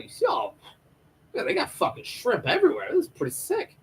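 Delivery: very high, whimpering squeak; mock tearful. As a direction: squeak it through pretend tears. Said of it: "crying in the back".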